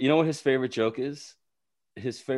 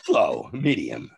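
slow, deliberate Walken cadence; lightly imitated Christopher Walken; Walken impression; 4 dB louder, much slower than usual. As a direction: drag it out like Walken.

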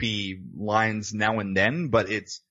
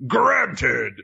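Djinn voice; 4 semitones higher, 4 dB louder than usual. Retold or imitated. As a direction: boom it out like a video game announcer. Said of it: "the Djinn voice just goes".

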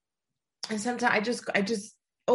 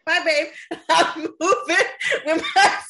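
high, louder, slow sing-song farewell, laughing; playful mock goodbye; 7 semitones higher, 6 dB louder, slower than usual. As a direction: tease him with a breezy goodbye. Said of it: "I'm gonna be like".

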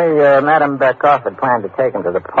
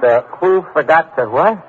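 baffled question; 9 semitones higher than usual. Acted. Baffled by French words.

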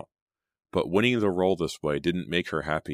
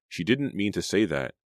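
ordinary delivery, nothing marked

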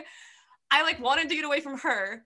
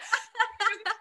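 sob; 10 semitones higher than usual. Retold or imitated. Acting out uncontrolled crying.

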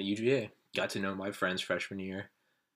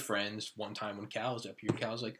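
ordinary delivery

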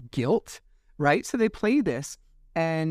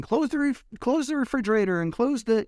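raised-pitch mock yell; retold quarrel outburst; 8 semitones higher than usual. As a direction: re-enact an irritated outburst, high but not truly shouted. Replaying own outburst while slamming doors.

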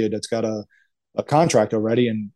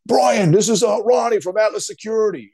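imitating Ronnie's Bronx accent, much higher and louder; heavy Bronx accent; 11 semitones higher, 4 dB louder than usual. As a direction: shout it in a thick Bronx accent. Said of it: "with that heavy Bronx accent".

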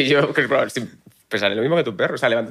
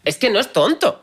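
mocking imitation; 11 semitones higher, slower than usual. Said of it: "tú dices".

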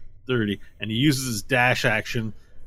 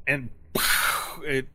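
dramatic slow reveal; slower than usual. Acted.

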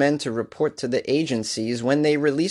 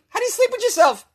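high squeaky disbelief; squeaky outraged; 3 dB louder, faster than usual. Real, not acted. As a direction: squeal it in disgust. Outraged at the lawyer.

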